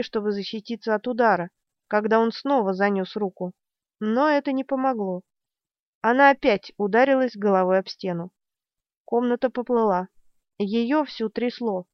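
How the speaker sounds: ordinary delivery, nothing marked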